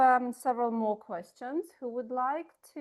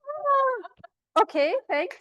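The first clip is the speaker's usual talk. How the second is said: surprised delight; 11 semitones higher, 9 dB louder, much slower than usual. Real, not acted. Reacts to "I came for this.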